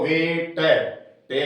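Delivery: वेट टे said very slowly, ending on a clear ट; sounding out weight. Shown as a demonstration. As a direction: stretch out वेट टे to show the final ट. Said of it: "बोल के देखो". (वेट टे धीरे-धीरे बोलकर दिखाना)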